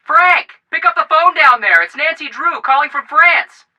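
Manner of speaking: calling out. Acted. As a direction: shout to Frank below that the phone is for him. Joe calls brother to phone.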